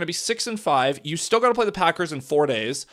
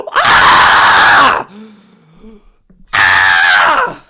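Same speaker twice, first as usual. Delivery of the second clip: loud raw scream; frustrated scream; 5 semitones higher, 15 dB louder than usual. Real, not acted. Fan screaming at his losing team, raw yell.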